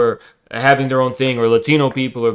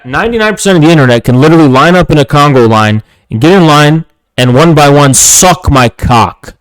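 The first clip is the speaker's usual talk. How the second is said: loud, furious rant at the troll; angry shouting; 5 dB louder than usual. Real, not acted. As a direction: blast the hater at full volume. Streamer rages at a chat troll.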